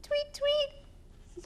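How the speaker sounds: very high little tweets; bird chirps. Acted. Reading to kids, very high baby bird tweets.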